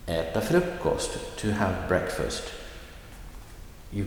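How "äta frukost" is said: äta short, frukost stressed; phrase stress. Shown as a demonstration. Teacher repeating the example. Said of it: "The äta is short".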